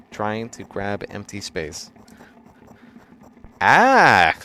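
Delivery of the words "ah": sudden high, loud yell; short burst of a scream, rising then dropping. Acted. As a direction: let out a sharp "Ah!" at full volume. Yelp while reading painful fall.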